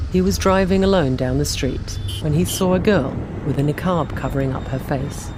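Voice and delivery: ordinary talk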